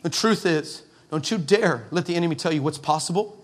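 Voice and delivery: ordinary talk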